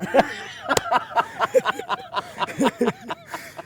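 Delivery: very high laughing burst; shrill laugh. Real, not acted. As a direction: laugh hard at the punchline. Laughs after punchline. (carcajada muy aguda)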